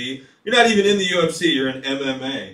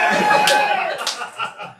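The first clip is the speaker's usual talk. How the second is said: a high, whooping crowd cheer; imitating a crowd hollering back; crowd yell. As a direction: yell yeah like a rowdy fair crowd. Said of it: "everyone goes".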